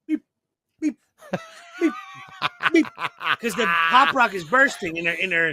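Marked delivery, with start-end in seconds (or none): 0.1-2.9 tiny spaced-out beeps; imitated bird hiccup. Storyteller mimicking birds with Pop Rocks bursting, short comic beeps. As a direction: squeak out brief, separate bird blips. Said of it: "the birds would just start going".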